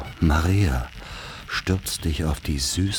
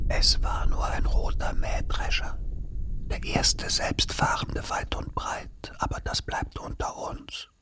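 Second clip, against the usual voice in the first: whisper; 6 dB quieter than usual. Acted. Secret told, shushing.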